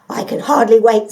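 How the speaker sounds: loud eager; can't wait to go. Acted. The dog eager for the pond, loud.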